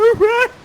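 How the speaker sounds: bright high burst of laughter; real laugh breaking out. Real, not acted. Host laughing at his own hammy performance. Laughs after hammed line.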